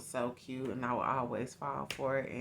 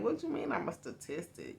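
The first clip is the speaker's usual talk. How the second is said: higher-pitched "What you mean I'm a statistic?"; confused disbelief; 8 semitones higher than usual. Retold or imitated. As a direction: repeat the word back, stunned. Confused teen answers cousin.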